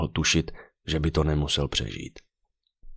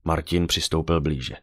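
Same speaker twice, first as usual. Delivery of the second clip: usual speaking voice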